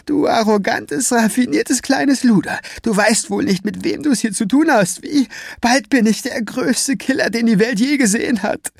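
high, strained, sneering thug voice; villain's rant. A narrator's reading. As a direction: taunt her from high in the throat. Villain Scapegrace rants.

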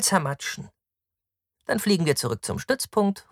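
high-pitched, rousing woman's voice; cruel, eager woman, high throughout; scornful Victra, impatient. Acted. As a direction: urge them on to crush him, pitched high.

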